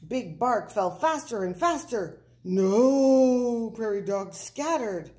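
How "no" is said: loud, high, drawn-out cry; alarmed shout. A narrator's reading. Prairie dogs watch Big Bark falling, alarmed shout. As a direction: howl it in dismay.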